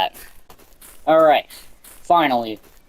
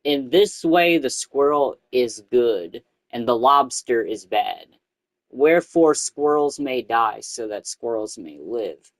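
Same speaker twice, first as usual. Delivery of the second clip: solemn reading; 4 semitones lower, 3 dB quieter than usual. Acted. Reading the strange cult book aloud, solemn recital. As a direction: intone it like holy text. Reads in-game scripture solemnly.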